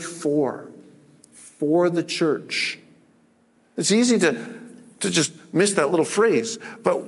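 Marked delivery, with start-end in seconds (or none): 0.0-2.8 slow, weighted "for" in "For the church"; "for" spoken slowly and deliberately; stress on for. Shown as a demonstration. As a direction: lean on the little word "for", drawn out. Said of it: "Don't miss that little word".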